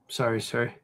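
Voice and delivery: usual speaking voice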